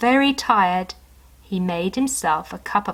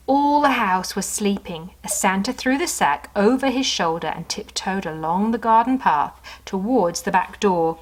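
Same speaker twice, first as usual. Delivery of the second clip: usual speaking voice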